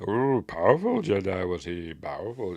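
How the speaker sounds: imitated Yoda. Acting Yoda's reply to Luke.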